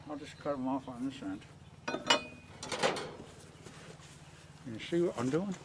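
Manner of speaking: talking around a pencil held in the teeth; words muffled by a pencil between the lips; pencil in mouth. Real, not acted. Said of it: "Talking with a pencil in my mouth again".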